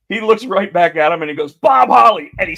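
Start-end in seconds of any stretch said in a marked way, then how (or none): 1.5-2.3 loud, high mock battle cry; acted-out shout, pitched way up; shouted outburst. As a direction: yell the name out as you strike. Wrestler's cry retold after chop.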